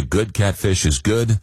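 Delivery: ordinary talk, nothing marked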